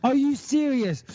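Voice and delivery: high, pleading little dog voice, high and pleading on Please; mock panicked pet voice; voicing the scared dog. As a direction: beg like a terrified pup. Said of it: "He's like".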